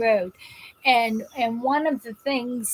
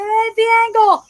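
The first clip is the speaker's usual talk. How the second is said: much higher and louder, half laughing; emphatic repeat; 10 semitones higher, 8 dB louder than usual. Retold or imitated. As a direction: insist on it with a laugh.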